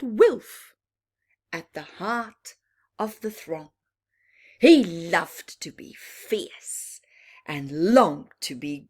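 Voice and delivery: lively read-aloud with bouncing rhyme; rhyming story narration. A narrator's reading. Reading a rhyming bedtime book to children, lively. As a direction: tell it to children, full of drama.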